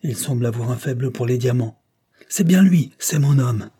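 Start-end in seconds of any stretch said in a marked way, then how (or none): 2.3-3.7 high, loud burst of recognition; excited interruption. A narrator's reading. Brother recognising the described man, interrupting excitedly. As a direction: cut in, higher and louder, sure of it. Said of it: "interrompit Richard".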